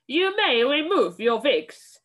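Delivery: a menacing witch snarling a command. Acted. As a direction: snarl it at the crowd. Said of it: "snarled the Grand High Witch".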